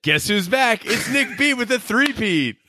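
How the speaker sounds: high, playful hype announcement; mock fanfare. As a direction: herald a returning champion in a high, hammed-up voice. Mock announcement of returning writer.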